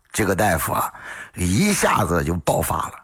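plain reading voice

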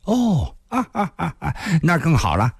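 high "oh" breaking into chuckles; delighted laugh. A narrator's reading. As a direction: laugh with delight as the young man. Youth delighted by girl's answer. (高兴得哈哈笑)

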